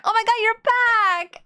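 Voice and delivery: high thrilled squeal; excited squeal. Retold or imitated. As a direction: gush like a fan. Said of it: "And I'm like".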